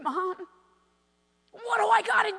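high, loud, pleading wail; imitating a distraught kid's plea; imitated tearful son. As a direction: act out an overwrought boy begging his mother. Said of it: "of course he began to cry".

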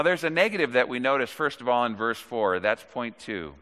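normal talking voice

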